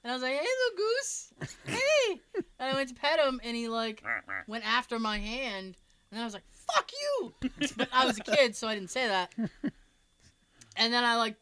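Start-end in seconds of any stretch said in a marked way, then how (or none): 0.3-2.2 high-pitched coo to an animal; retold coaxing. Kid approaching a little goose to pet it, high coaxing call. 6.5-7.3 high-pitched mock-angry yelp; retold indignant outburst. Kid after goose went for his hand, high mock outrage.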